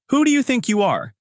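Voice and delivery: high-pitched indignant challenge; angry outburst. A narrator's reading. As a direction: berate them as an irate manager, pitch jumping up. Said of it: "Manager Park continues".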